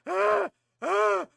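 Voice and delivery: loud high panicked shrieks; mock shriek. Player fleeing the ghost, loud mock shrieking.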